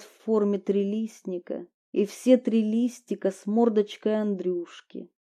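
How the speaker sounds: plain reading voice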